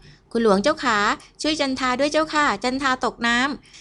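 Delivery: high, drawn-out cry for help; acted child's plea, pitched high; child calling for help. A narrator's reading. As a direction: call out slowly like a child in trouble. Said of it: "เสียงเด็กร้องตะโกนเรียกหาผู้ช่วย".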